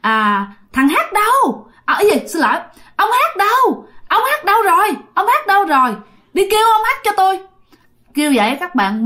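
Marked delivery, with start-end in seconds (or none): 0.7-7.4 possessed man shrieking in a high, womanish voice; high squeaky woman's voice. Retold or imitated. Possessed man demands the old man, in a high squeaky woman's voice. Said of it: "cái giọng eo éo như là đàn bà".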